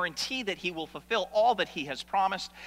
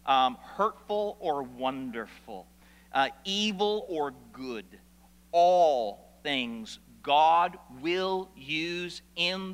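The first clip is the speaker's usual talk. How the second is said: slow, weighty answer; 2 semitones lower, much slower than usual. Shown as a demonstration. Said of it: "I think it all fits".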